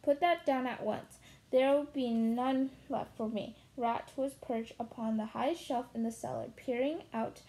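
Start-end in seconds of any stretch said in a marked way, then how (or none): none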